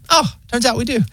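casually unbothered AI; weirdly chill robot. Retold or imitated. Pharmacy AI finding the record, high and breezy. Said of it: "it's so weirdly chill".

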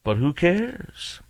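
slow, drawn-out sneering imitation; mocking echo. Host mimicking the senator, slow and sneering. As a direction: stretch it out to ridicule him.

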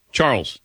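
Charles clipped into a single syllable; one-syllable Charles. Shown as a demonstration. Host correcting the caller with a single-beat Charles. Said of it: "I don't say Charles".

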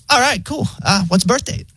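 high, laid-back robot assistant; breezy bot asking for a birth date; chill AI voice. Retold or imitated. Pharmacy AI phone bot asks the caller casually, high and chill. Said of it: "so chill".

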